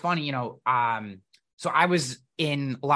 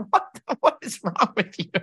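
incredulous; 6 semitones higher than usual. Retold or imitated. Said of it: "And I'm like".

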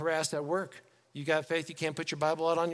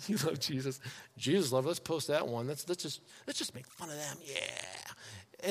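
low, hushed sneering imitation; mocking schoolmates; 5 semitones lower, 9 dB quieter than usual. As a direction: ridicule them under your breath, plotting. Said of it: "You get made fun of".

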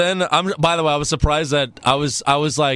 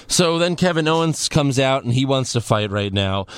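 usual speaking voice

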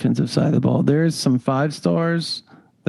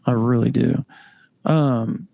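ordinary talk, nothing marked

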